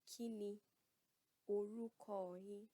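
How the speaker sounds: "Kí ni orúkọ yín?" broken apart, "orúkọ" drawn out; slow, respectful asking of a name; slow polite question. Shown as a demonstration. Teacher models asking an elder's name, slowly. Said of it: "Repeat after me".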